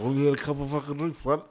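tongue barely moving, words smeared; drunk slur. Shown as a demonstration. Player showing how to act drunk, lazy-tongued slur. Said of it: "not use your tongue very much".

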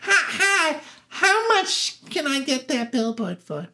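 high, giggly imitation of an eager gal; Gladys Glover voice. Gladys asking about renting the billboard, high eager voice. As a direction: pitch it up with a little laugh. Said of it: "and she goes".

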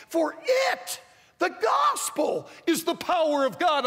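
full-force preacher's cry; preaching shout. Real, not acted. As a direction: cry it out from the pulpit. Preacher hits key phrase.

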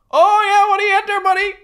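very high, loud mock Newfoundland greeting; excited Newfoundlander impression. Fella from Newfoundland answering a stranger, mimicked in a high, loud yell. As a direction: squawk it back like an overjoyed Newfoundlander. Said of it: "That, that fella's from Newfoundland".

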